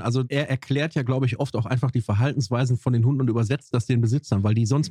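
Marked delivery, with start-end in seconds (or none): none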